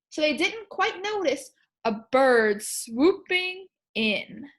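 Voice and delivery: lively rhyming read-aloud; storybook read-aloud. Acted. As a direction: read the poem to the class.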